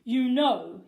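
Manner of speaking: principal. Acted. Krupp voiced.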